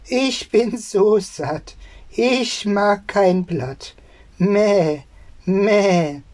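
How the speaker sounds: high, slow sing-song goat; bleating goat. Acted. Goat telling the boy it is full, high and bleating. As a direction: draw it out high, then bleat. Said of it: "antwortete die Ziege".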